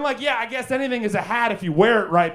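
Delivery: ordinary delivery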